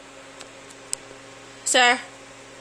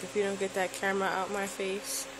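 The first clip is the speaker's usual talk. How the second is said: lower, softer voice for the dog, lightly done; voicing the dog; 4 semitones lower, 9 dB quieter than usual. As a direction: give the dog a low grumbling voice. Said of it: "He's like".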